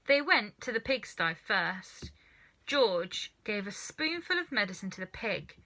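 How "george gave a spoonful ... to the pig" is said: plain reading voice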